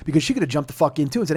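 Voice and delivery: ordinary delivery, nothing marked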